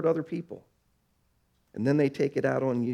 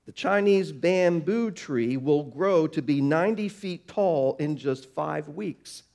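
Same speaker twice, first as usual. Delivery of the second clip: normal talking voice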